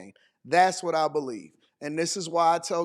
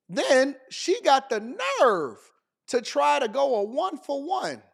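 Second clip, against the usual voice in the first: indignant; 7 semitones higher than usual. Real, not acted. Indignant at the woman's nerve.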